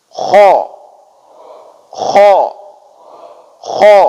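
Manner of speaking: kha rasped hard from the back of the throat; throaty kha drill. Shown as a demonstration. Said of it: "Ya, kita ulangi".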